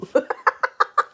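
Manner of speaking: high, gleeful cackling laugh; cackle. Acted. Narrator laughs after the boo, gleeful cackle. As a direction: laugh like a pleased little prankster.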